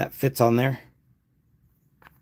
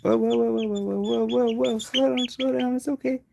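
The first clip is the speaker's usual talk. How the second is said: soothing a pet; 10 semitones higher than usual. Real, not acted. Calming the flapping chick.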